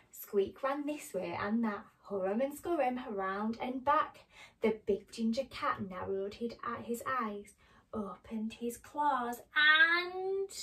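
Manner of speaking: animated picture-book reading; story narration to children. A narrator's reading. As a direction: read it to small children with careful, dramatic phrasing.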